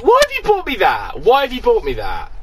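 high, exasperated mock complaint; mock exasperated mum. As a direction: squeal it like a baffled parent. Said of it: "it's like".

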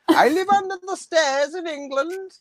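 very high, drawn-out puppet lament; wailing puppet. Pretend. Puppet likening itself to Harry Potter, high mock wail.

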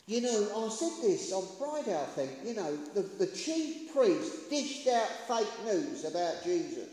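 ordinary talk, nothing marked

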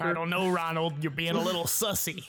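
bad Rick impression. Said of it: "Kinda sounds like you're trying to do a bad Rick impression".

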